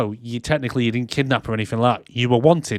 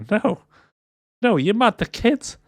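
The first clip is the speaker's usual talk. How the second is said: incredulous refusal; 9 semitones higher than usual. Retold or imitated. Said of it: "And he'd be like".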